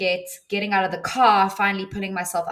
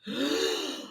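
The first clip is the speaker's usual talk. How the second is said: quick breathless intake of surprise; sharp gasp; 7 semitones higher, 4 dB quieter than usual. Retold or imitated. Recalling spotting the man's truck, a sudden gasp. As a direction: gasp as if just spotting rescue.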